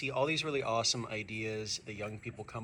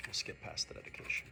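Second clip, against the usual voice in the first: plain reading voice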